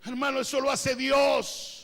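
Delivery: shouted slow pulpit declaration; preaching shout. Acted. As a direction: call it out like an amen moment. Preacher exclaims to congregation.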